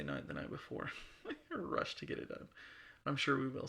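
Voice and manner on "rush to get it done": laughing. Real, not acted. Joking about last-minute recording.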